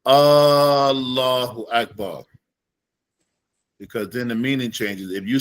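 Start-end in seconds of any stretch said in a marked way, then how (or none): 0.1-2.2 very slow Allahu Akbar with the first A drawn out; teacher demonstrating the error; wrong long hamza. Shown as a demonstration. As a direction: stretch the opening A of Allahu too long. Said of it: "So you don't say".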